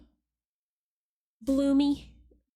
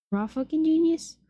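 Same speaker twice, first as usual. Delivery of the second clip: "Raw fucking genius?" asked higher and louder in disbelief; incredulous repeat; 6 semitones higher, 3 dB louder than usual. Real, not acted. Streamer repeating a rude annotation to chat, high and incredulous. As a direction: throw it back up high, can't believe it. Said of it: "They're being mean".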